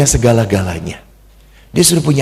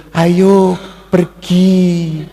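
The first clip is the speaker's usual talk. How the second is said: very slow, drawn-out, higher gentle lilt; refined Solonese politeness; 3 semitones higher, much slower than usual. Acted. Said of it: "ngomongnya halus-halus". (menirukan orang Solo yang halus, mendayu-dayu)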